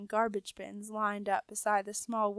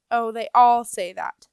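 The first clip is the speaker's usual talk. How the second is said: dismissive; 10 dB louder than usual. A narrator's reading. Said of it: "said the rat miss dismissively".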